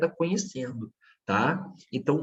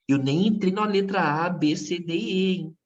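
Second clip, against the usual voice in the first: excited; 5 semitones higher than usual. Real, not acted. Excited teacher, not yet.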